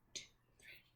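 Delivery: whispered count on; teacher counting on in a whisper. Shown as a demonstration. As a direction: count under your breath. Counting on under her breath.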